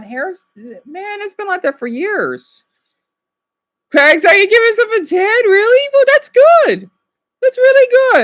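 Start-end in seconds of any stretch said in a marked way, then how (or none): 3.9-8.2 high, loud, delighted surprise; real surprise. Real, not acted. Streamer reacting to a chat member's ten, high excited surprise. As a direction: burst out high and loud, impressed.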